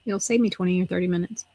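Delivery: ordinary delivery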